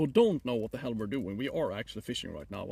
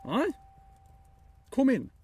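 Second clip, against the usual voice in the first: loud, high Swedish call; 12 semitones higher, 8 dB louder than usual. Real, not acted. Streamer calling someone to come in, loud call.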